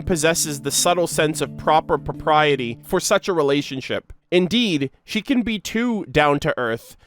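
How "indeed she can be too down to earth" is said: usual speaking voice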